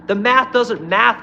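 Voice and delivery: slow, loud and high; slow emphatic punchline. Real, not acted. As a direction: punch each word slowly.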